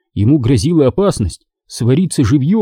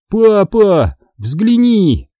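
high pleading call; 8 semitones higher, much slower than usual. A narrator's reading. Boy begs his father to look at the comic, high pleading. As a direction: beg dad to look. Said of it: "взмолился я".

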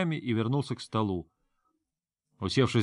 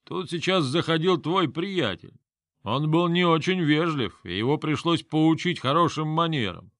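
put-on drunk; 5 semitones higher than usual. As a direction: slur it like a tavern braggart. Drunk Yugor boasting.

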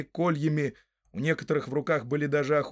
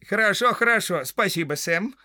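high, louder put-on woman's voice; farmer's wife; 7 semitones higher, 5 dB louder than usual. A narrator's reading. Farmer's wife thanking visiting hobbit, high female voice. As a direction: answer as a lively housewife.

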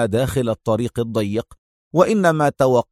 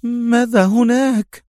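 slow, higher woman's voice, anxious; slower, more uneasy question; more worried; 11 semitones higher, slower than usual. A narrator's reading. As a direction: press him, worry growing. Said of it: "بمزيد من القلق".

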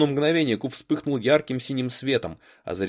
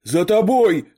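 high, loud urgent cry; warning shout; 9 semitones higher, 10 dB louder, slower than usual. A narrator's reading. Boy warns his partner from inside the mirror, shouted. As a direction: yell a warning to your partner. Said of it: "крикнул Грег из зеркала".

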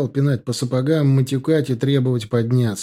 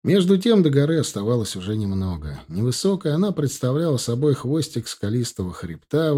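normal talking voice

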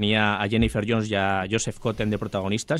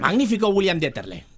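mock critic praise; 7 semitones higher than usual. Continuing the critic impression.

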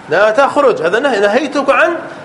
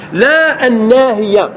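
ordinary talk

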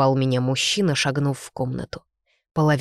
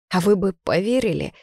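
higher-pitched bitter challenge; 5 semitones higher than usual. A narrator's reading. Woman doubts he would have believed her, bitter. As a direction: throw the question back with mild bitterness. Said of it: "Горько усмехнулась".

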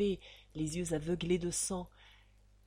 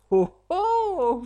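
loud, high mocking "oh, oh"; mocking shout; 11 semitones higher, 13 dB louder than usual. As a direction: bellow it out in scorn. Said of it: "fit Ragtal".

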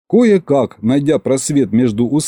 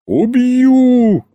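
high, drawn-out threat; furious cry; 12 semitones higher, 4 dB louder than usual. Acted. Tsar threatening his fawning butler, furious cry. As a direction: howl it out in exasperated fury. Царь в ярости на дворецкого.

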